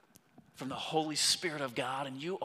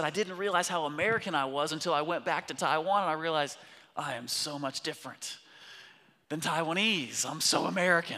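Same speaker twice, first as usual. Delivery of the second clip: higher and louder, fast, retelling his friend's confession; retold friend's realization; 4 semitones higher, 7 dB louder than usual. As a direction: voice the friend's surprise about being American with rising force. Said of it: "But he said".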